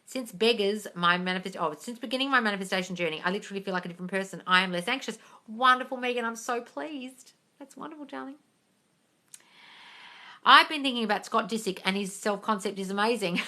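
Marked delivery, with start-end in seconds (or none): 5.5-8.4 soft gushing. Real, not acted. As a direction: coo it tenderly. Sweet praise to a viewer.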